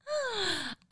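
sharp high mock gasp. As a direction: gasp in pretend shock. Mock shock at valley.

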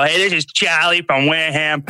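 loud, high-pitched angry Boston fan caller; worked-up Boston caller ranting on the radio. Retold or imitated. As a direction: rant like a fed-up sports radio caller. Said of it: "I can just picture some guy fucking calling in the Boston radio".

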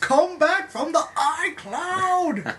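slow, high, loud plea; mock lament. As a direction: draw out a dramatic plea.

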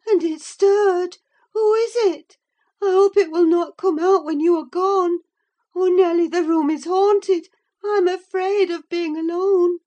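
high, loud, frightened pleading; terrified of being left alone; anxious. A narrator's reading. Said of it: "she pursued anxiously".

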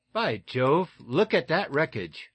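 jubilant. A narrator's reading. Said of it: "uttered Lieutenant Danvers jubilantly".

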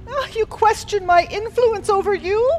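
loud, quick, affronted; indignant villain. Acted. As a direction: rise up, offended. Villain bristles at challenge.

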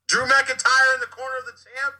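high, loud ring-announcer call. Pretend. Podcaster announces who is in champion's corner, announcer voice. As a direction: belt out the introduction high and loud.

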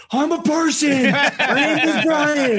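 high, loud, slow wrestling promo. Retold or imitated. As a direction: bellow it like a monster truck announcer. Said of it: "I pull out my wrestling voice".

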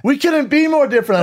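hammed-up outraged barista; retold indignant shout. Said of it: "She goes".

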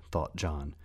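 plain reading voice

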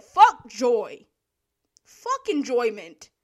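loud, punched proclamation; hammed-up loud decree; mock declaration. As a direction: imitate a killjoy laying down the law. Voices manager killing the fun.

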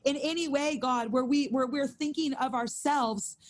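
intercessory prayer to the Lord; earnest prayer. Acted. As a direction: pray it out over the room. Leads the prayer.

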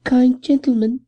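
high thin pleading voice; a thin old beggar woman whining for alms. A narrator's reading. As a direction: voice her high and thin, wheedling.

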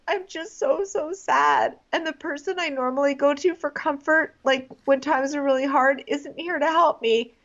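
high, mock distraught lament; Annalise. Annalise laments a guy she barely knows, mock heartbroken. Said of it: "Annalise p-, has that scene".

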